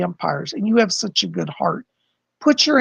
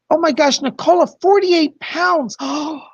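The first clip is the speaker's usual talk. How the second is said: high, loud gasping exclamation over a gift; thrilled disbelief, voice shooting up; real shocked delight; 7 semitones higher, 3 dB louder than usual. Real, not acted. Reader stunned by a big donation, excited and loud.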